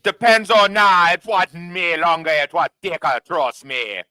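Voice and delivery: put-on slow, loud, high Jamaican accent. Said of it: "I'll do it in a Jamaican accent".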